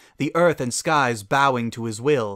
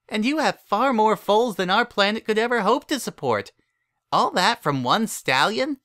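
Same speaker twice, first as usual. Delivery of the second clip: put-on high childish voice, lecturing; a little filly proving the numbers wrong; know-it-all filly arguing; 8 semitones higher than usual. A narrator's reading. Twilight argues the story's maths with Cadance, indignant.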